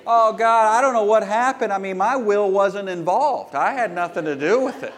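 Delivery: high, loud, fast whiny excuse; mock excuse. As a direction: ham up a sinner's innocent protest.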